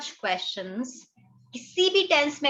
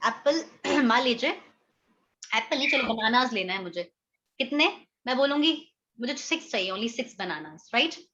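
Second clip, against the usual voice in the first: usual speaking voice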